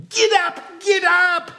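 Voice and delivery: very loud, high, drawn-out yell; frustrated full-voiced shouting; desperate shout. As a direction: holler at stubborn animals, near crying and swearing. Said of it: "and he shouted".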